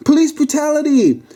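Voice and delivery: high-pitched outraged cry; frantic high shout to onlookers. Acted. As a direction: cry out in protest, voice way up. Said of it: "the lady shouted".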